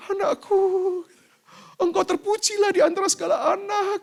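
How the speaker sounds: high, loud, mock-tearful mother; touched mother. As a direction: ham up a weeping proud mother. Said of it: "Mama saya akan terharu dan berkata". (ibu terharu pura-pura menangis, tinggi)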